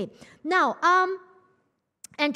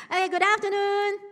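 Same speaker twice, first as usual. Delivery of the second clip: energetic greeting; 9 semitones higher than usual. Shown as a demonstration. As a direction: wake the room up with the greeting. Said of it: "not very keen, huh?".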